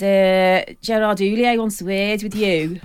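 lightly voiced bouncer summons; burly bouncer. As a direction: announce it slowly like hired muscle. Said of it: "big burly bouncer".

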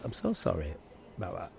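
low, soft, sheepish sorry; hushed apology. Acted. Host to the punched customer, quietly sorry. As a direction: drop right down into a quiet apology.

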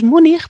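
"Múnich" ending in a raspy Spanish jota; guttural ending. Shown as a demonstration. Linguist showing the mistaken version, "Múnich" with final jota. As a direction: say "Múnich" as if spelt Múnij. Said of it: "la C H será G".

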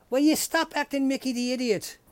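usual speaking voice

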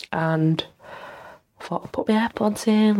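ordinary delivery

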